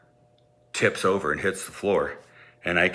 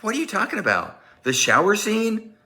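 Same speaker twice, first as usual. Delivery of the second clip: incredulous mom; 13 semitones higher than usual. Retold or imitated. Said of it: "She's like".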